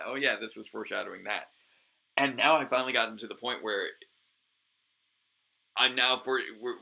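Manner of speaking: ordinary delivery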